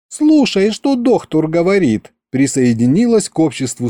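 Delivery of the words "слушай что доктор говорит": high scolding woman's voice; scolding wife. Acted. Wife scolds her husband to obey the doctor, high-pitched.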